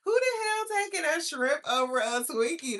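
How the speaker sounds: laughing so hard it sounds like crying; real breathless laughter. Real, not acted. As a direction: wheeze the words out through laughter.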